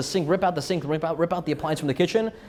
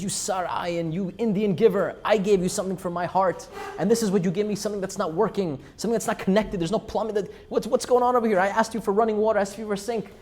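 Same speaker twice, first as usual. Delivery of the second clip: raised, pitched-up angry rant; a mock furious chief; angry yelling; 6 semitones higher than usual. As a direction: tell him off like you were cheated. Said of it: "he starts yelling".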